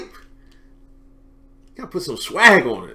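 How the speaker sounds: ordinary talk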